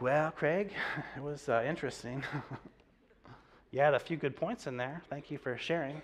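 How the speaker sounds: a kind Alabama classmate softening a bad review with a laugh; sweet Southern woman. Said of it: "sweet Southern woman".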